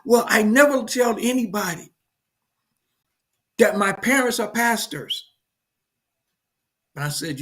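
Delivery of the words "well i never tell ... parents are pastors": put-on a hurt little girl; young daughter's voice. Daughter tells her father in the car, high and hurt. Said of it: "She says".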